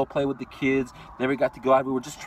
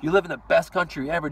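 ordinary talk, nothing marked

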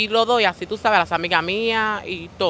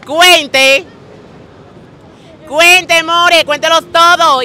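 high, loud, slow boast; boastful shout; 8 semitones higher, 10 dB louder, much slower than usual. Pretend. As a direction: dare her to count them all. (presume gritando, agudo y lento)

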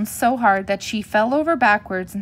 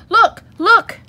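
very high, loud cub shouts; excited shout; 12 semitones higher, 12 dB louder than usual. A narrator's reading. Cubs spotting toys in the parking lot, shouting excitedly. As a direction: shout each word high and urgent. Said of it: "shouted the cubs".

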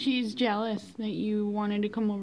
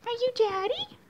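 squeaky pet talk; 12 semitones higher than usual. Real, not acted. Asking the kitten in a squeaky baby voice. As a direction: coo it up high at the cat. Cooing to the cat.

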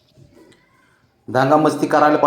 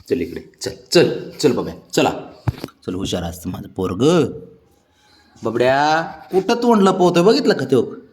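low, soft, affectionate coaxing; softly calling the sulking pet over; gentle coaxing; 4 semitones lower, 3 dB quieter than usual. Real, not acted. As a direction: coax him over tenderly.

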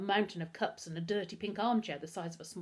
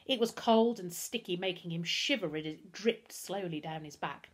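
ordinary delivery

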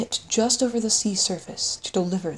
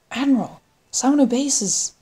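alarmed call, raised high; urgent shout; 7 semitones higher, 5 dB louder than usual. Acted. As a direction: cry out to the Admiral. Alarmed call as base falls.

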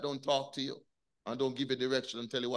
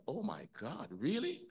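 soft, rising stunned "really"; dismayed disbelief; 3 semitones higher, 6 dB quieter than usual. Retold or imitated. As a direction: let the disbelief rise on "really". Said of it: "I'm like".

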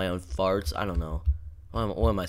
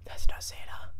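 breathy whisper, barely voiced; hushed whisper. Real, not acted. Player spotting the stranger in the house, hushed whisper. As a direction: whisper it so the intruder won't hear. Creepy intruder on screen.

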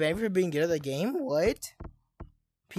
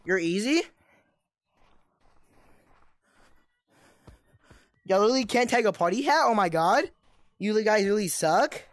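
put-on cocky trash-talking kid, a bit higher, taunting; toxic gamer kid; 4 semitones higher than usual. Gamer taunting lobby players as smug bully kid. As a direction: sneer at the other players like a smug little bully.

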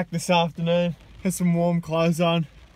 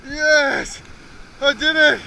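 higher, excited shout of victory; triumphant cheer; 7 semitones higher, 4 dB louder, much slower than usual. Real, not acted. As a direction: celebrate landing the catch. Fish finally landed.

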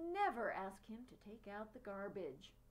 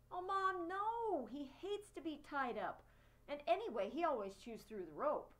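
pleading; 6 semitones higher, 3 dB louder than usual. A narrator's reading. Boy pleading with his mother.

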